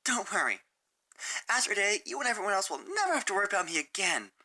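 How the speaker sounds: high, tearful, rushed; crying. Acted. Sunset breaking down before running off, tearful. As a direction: choke it out on the verge of tears. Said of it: "she cried".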